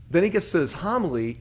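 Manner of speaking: ordinary talk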